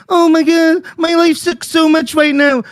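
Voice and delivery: high, drawn-out self-pity parody; mock whining. Angry man mimicking his opponent's self-pity, mock whining. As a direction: whine it in exaggerated mockery.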